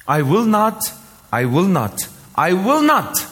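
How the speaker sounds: "I will not" punched out, loudest on the last; emphatic repetition. Shown as a demonstration. Preacher reading God's promise to the congregation, emphatic. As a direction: hammer "I will not" with high, rising force. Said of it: "The emphasis is mentioned here".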